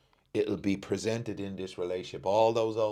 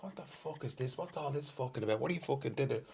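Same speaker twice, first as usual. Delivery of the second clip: high, hushed inner fury; inner outrage; 8 semitones higher, 4 dB quieter than usual. Retold or imitated. Inner reaction to partner's Hoover question, high and hushed. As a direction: seethe inside without raising volume. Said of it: "And inside I'm like".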